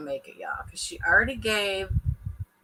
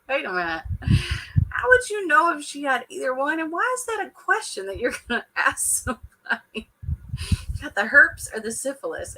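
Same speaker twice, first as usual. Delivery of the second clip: disbelieving, raised pitch; incredulous; 6 semitones higher than usual. Retold or imitated. Viewer reacting to the show, baffled and incredulous. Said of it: "I was just like".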